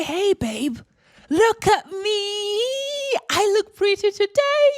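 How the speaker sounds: high, preening show-off voice; playful vanity. Pretend. Speaker pretending to send a selfie to a sibling, high and preening. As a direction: preen like you're showing off a selfie.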